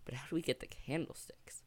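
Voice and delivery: a whispered question to yourself; hushed wondering; whispered puzzling. Retold or imitated. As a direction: whisper it, thinking hard. Puzzling quietly over the clue.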